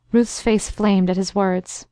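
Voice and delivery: ordinary delivery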